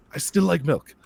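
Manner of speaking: put-on light thick Japanese accent. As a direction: imitate a Japanese guy, lightly. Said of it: "a thick Japanese accent".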